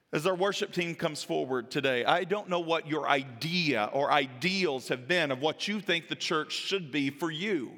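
usual speaking voice

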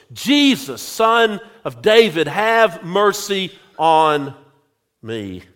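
ordinary talk, nothing marked

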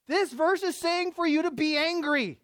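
high, loud astonished point; mock astonishment. Shown as a demonstration. Preacher feigning shock at the verse to the church, high and loud. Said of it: "Wow. Wait, wait".

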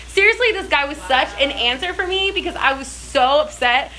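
usual speaking voice

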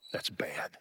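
softly whispered aside. Real, not acted. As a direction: breathe it out, barely voiced. Grieving over a funeral.

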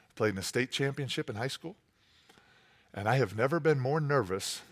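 preacher confiding quietly to the congregation; hushed aside. Real, not acted. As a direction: drop it low like a secret. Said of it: "hushed confession".

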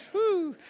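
loud, high-pitched whew; mock-daunted exhalation on a high note. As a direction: let out an overwhelmed whoop. Reacts to four hundred ninety times.

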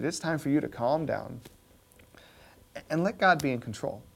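normal talking voice

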